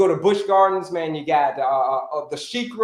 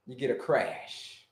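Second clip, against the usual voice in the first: slow, much lower, soft crash; 8 semitones lower, 10 dB quieter, slower than usual. Shown as a demonstration. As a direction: drop the voice low and slow on crash. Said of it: "Who can tell me".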